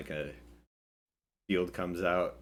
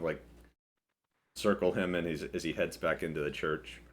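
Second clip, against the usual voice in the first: ordinary delivery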